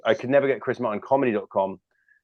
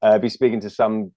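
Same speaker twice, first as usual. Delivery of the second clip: usual speaking voice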